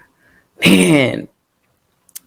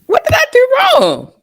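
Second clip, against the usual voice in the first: desperate plea to God. Retold or imitated. Preacher's kid mother pleading with God over her child. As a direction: cry out to God in disbelief.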